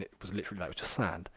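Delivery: whispered, almost under the breath; hushed aside. Real, not acted. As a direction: drop to a whisper for the punchline. Describing the bare desert picture.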